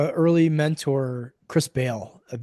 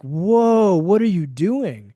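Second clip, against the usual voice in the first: alarmed whoa; 3 semitones higher, 4 dB louder, much slower than usual. Pretend. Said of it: "as opposed to being like".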